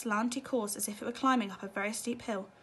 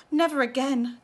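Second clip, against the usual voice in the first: gasped; 3 semitones higher, 7 dB louder than usual. A narrator's reading. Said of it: "gasped Missus T V".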